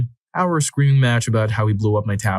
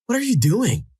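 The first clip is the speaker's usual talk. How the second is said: mock yelp of alarm; retold shout; 13 semitones higher than usual.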